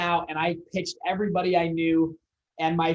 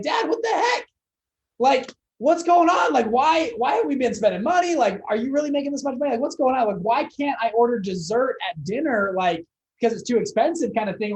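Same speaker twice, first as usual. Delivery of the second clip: high-pitched, retold exasperated demand; incredulous outburst; 7 semitones higher, 4 dB louder than usual. Son confronting his dad about hidden money, incredulous. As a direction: replay a bewildered son demanding answers.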